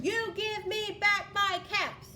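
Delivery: slowly scold, each word pressed out; stern scolding demand. Acted. Peddler demands his caps back from the monkeys, stern and loud. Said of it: "This made the peddler angry".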